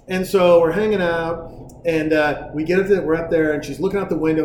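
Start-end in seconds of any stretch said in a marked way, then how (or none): none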